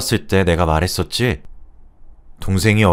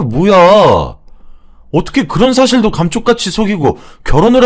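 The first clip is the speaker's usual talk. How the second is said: raised, high-pitched furious scolding; angry yelling; 6 semitones higher, 4 dB louder than usual. A narrator's reading. Brother berates sister and her boyfriend over secret wedding plans, angrily raising his voice. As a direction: build into a loud, outraged telling-off. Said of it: "큰 소리를 내며 화를 내니".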